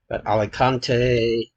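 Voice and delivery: ordinary talk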